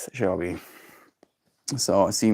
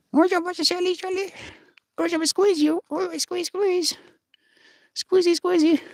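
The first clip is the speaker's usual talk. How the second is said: much higher cutesy cooing. Real, not acted. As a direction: coo at the cat in a tiny high voice. Baby talk to pet.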